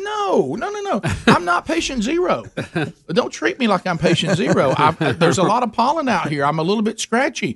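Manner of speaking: high-pitched, laughing, hammed-up denial; frantic denial. Husband pleading with his wife, high frantic mock protest. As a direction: squeak out the protest, half laughing.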